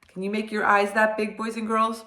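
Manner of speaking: usual speaking voice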